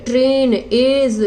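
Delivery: ट्रेन, एज drawn out slowly, gliding ए into ई; slow diphthong demo. Shown as a demonstration. Teacher drilling students on the ए-ई diphthong, slowly. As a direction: stretch each word so both vowels ring. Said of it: "दोनों की sound आ रही है".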